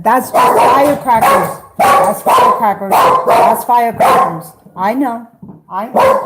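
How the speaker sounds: calling it out loudly over the noise; loud reassurance. Real, not acted. Owner telling her scared dog it's just firecrackers, loud.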